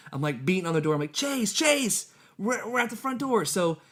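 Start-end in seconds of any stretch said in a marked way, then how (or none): 1.1-3.4 calling out. Retold or imitated. Calling son to door.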